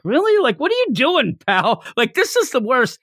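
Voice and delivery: high, mocking disbelief; incredulous scoff. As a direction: sneer at them from the crowd. The other people scoff.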